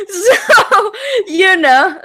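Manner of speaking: breaking into laughter; laughing. Real, not acted. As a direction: laugh through the words. Laughing about Mexico elections.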